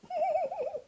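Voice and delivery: tiny high squeaky giggle; mice laughing. Acted. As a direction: giggle like a cheeky mouse. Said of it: "The little mice only laughed".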